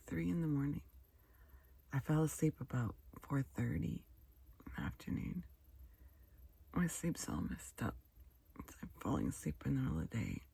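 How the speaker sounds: ordinary talk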